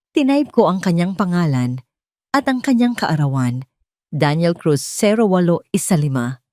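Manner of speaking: ordinary delivery, nothing marked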